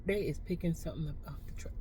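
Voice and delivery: quick hushed whisper. Real, not acted. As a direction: whisper it so he won't hear. Hushed remark about a stranger nearby.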